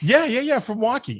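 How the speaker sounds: high, bright burst of yeahs; eager excited agreement; excited yes. Real, not acted. Excited confirmation of odd injury.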